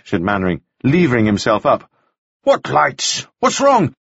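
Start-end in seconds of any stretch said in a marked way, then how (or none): 2.4-3.9 raised, urgent demand; alarmed questioning. A narrator's reading. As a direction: press him for an answer, voice climbing. Alarmed master presses breathless boy.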